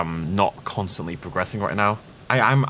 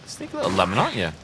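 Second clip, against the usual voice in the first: put-on cutesy baby talk; 3 semitones higher, 3 dB quieter, faster than usual. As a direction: coo at it like a tiny pet. Talking to a hidden creature.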